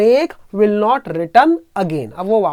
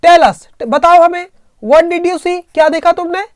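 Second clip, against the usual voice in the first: louder, higher, urgent question; urgent demand; 6 semitones higher, 4 dB louder than usual. Retold or imitated. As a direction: press her to tell, voice raised. Grandmother questions the frightened aunt. (ऊँची, ज़ोरदार आवाज़ में बेचैन सवाल)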